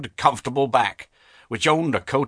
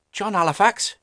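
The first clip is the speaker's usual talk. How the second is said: put-on high, simple boy's answer; earnest poor boy; 9 semitones higher than usual. A narrator's reading. Boy answers the stern master, lightly voiced earnest boy. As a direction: answer plainly as the young lad, pitched up.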